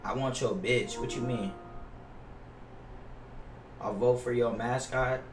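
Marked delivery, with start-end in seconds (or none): none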